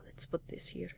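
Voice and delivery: soft unvoiced whisper; whispered aside. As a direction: whisper it under your breath. Quiet aside while arranging things.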